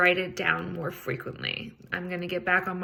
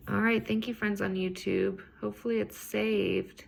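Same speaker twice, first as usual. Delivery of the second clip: usual speaking voice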